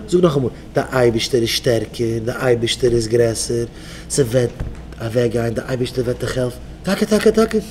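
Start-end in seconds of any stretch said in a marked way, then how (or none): 0.7-6.6 low, soft affirmation; calm mantra. Shown as a demonstration. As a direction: recite it low and steady, for repeating. Models a mantra to repeat.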